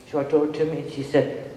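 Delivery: ordinary talk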